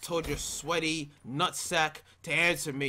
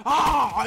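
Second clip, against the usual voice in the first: angry yell; 7 semitones higher, 9 dB louder than usual. Real, not acted. Raging scream at death.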